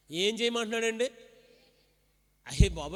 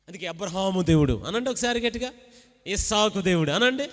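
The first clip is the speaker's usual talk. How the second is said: ordinary delivery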